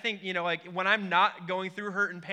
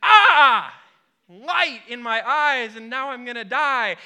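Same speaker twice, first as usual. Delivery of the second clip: loud, high hammed-up wail of being blinded, slowing down; overdone dying groan at the light; mock anguished cry; 3 semitones higher, 10 dB louder, slower than usual. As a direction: ham up waking to a blinding light with a pained cry. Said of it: "I'm woken up with".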